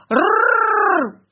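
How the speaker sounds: loud high rolling growl; dog growl. A narrator's reading. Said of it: "зарычал пёс".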